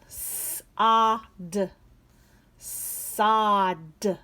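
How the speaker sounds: S whispered, ah loud, D, then Sod blended; sounding out. Shown as a demonstration. Teacher sounding out sod for kids. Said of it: "the third sound is D".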